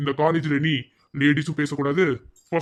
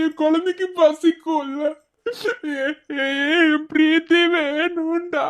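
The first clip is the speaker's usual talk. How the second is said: broken, tearful lament; crying.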